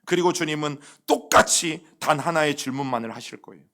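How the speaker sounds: usual speaking voice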